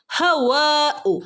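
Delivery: ഹവാഉ with the waa held two counts, very slow; long vowel. Shown as a demonstration. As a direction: stretch ഹവാഉ slowly. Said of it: "രണ്ടനക്കം നീട്ടി കൊടുക്കുക ട്ടോ". (ഹവാഉ രണ്ടനക്കം നീട്ടി)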